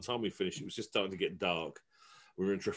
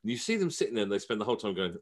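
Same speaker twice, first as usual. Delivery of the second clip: normal talking voice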